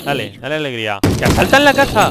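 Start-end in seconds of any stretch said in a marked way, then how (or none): 1.2-2.1 very high, loud yell; alarm cry. Real, not acted. Gamer fighting house raiders, high alarmed yell. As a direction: scream out the warning.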